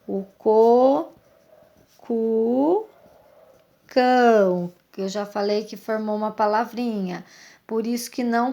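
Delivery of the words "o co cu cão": "co", "cu", "cão" each said slowly and clearly on its own; syllable sounds. Shown as a demonstration. Teacher sounding out "co", "cu", "cão" for pupils, crisp and separate. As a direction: sound out "co", "cu", "cão" for young readers. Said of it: "parecida com o K".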